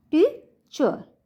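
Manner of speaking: Teacher slowly, टी high, चर falling; stress on first syllable. Shown as a demonstration. Teacher showing students the pronunciation, टी stressed in teacher. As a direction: lift and stretch टी in teacher, let चर fall. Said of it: "कैसे pronunciation होगा इसका".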